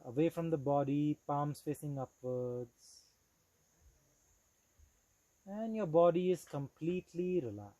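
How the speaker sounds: measured, deliberate yoga nidra guidance with long pauses; steady, clearly projected meditation instructor; guided meditation. Acted. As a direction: guide them into relaxed awareness, phrase by phrase.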